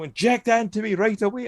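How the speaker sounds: high-pitched hammed-up urgency; mock desperate demand. Mocking Harry demanding an injection, high mock desperation.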